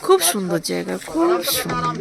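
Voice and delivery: soft, kept-down tour talk; hushed narration. Real, not acted. Vlogger shows the hills to viewers after being hushed, hushed. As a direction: show the view while keeping your voice down. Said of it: "আস্তে কথা বল".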